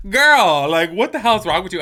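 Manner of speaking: exasperated outburst. Retold or imitated. Reacting to a clueless friend, loud exasperated outburst. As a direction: blurt it out in loud disbelief. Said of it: "I'm just kinda like".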